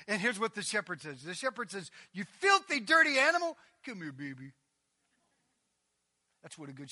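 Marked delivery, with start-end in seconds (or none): 2.1-3.6 high-pitched playful telling-off; mock scolding. Shepherd to filthy sheep, mock scolding. 3.8-4.5 low, soft endearment; tender coaxing. Acted. Shepherd to dirty sheep, tender coaxing.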